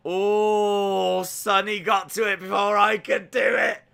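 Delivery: high, loud outburst; exclaimed dismay. Real, not acted. Streamer beaten to the timeout by another, loud high exclamation. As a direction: cry out at being beaten to it.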